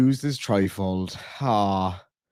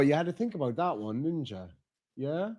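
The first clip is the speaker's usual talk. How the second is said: much softer, gently teasing the pup; soft teasing pet talk; 10 dB quieter than usual. Real, not acted. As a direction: tease the dog quietly, affectionately. Teasing the dog softly.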